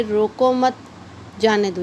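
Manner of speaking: "रोको मत" as one unit, pause, then "जाने दो" falling; pause after मत. Shown as a demonstration. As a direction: run रोको straight into मत, then break before जाने दो. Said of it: "विराम चिन्हों की क्या इम्पोर्टेंस है".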